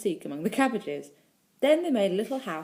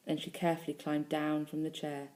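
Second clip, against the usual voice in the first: plain reading voice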